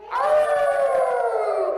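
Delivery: a high, loud howl; coyote howl. Shown as a demonstration. Teacher leads kids in yoga, loud coyote howl. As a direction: throw back your head and howl like a coyote. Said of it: "howl like a coyote".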